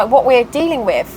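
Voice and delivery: ordinary talk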